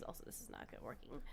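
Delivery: quick whispered aside. Real, not acted. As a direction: whisper it to yourself. Muttered aside under her breath.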